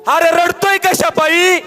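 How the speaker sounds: high, slow, scornful scold; mock scolding brother. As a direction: voice the dead brother mocking tears. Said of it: "वरून मलाच म्हणन". (मेलेल्या भावाचा हेटाळणीचा ओरडा)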